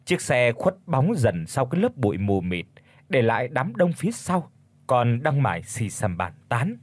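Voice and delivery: plain reading voice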